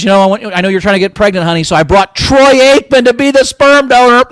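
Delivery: lightly mock a cheery TV line, loudly; mock sitcom coach. Mock sitcom coach pitching donor.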